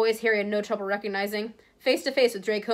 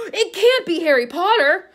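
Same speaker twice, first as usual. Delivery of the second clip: much higher and louder, slow and showy; thrilled exclamation; 8 semitones higher, 9 dB louder, slower than usual. Acted. Vain author spots Harry and shows off to the crowd, a loud slow thrilled cry. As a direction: proclaim it like a grand discovery.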